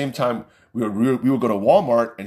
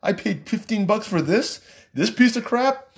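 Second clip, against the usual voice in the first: mildly annoyed grumble, pitched up; ticked off; 9 semitones higher than usual. Retold or imitated. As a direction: gripe about a wasted ticket. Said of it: "I was kinda ticked".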